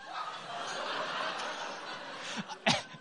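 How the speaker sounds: breathless silent laugh. Real, not acted. Laughing at retold moment.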